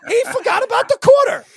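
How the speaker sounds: loud high exclaiming shout. Real, not acted. Host shouting at his guest over the forgotten quarter. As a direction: yell it out in disbelief. Repeats it, hammed up.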